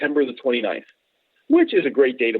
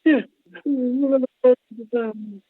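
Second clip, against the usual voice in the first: mobster line; 12 semitones higher than usual. Retold or imitated. Repeating the mob movie line for the host, mock mobster. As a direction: quote the mob line again, savouring it. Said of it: "Should've been against me".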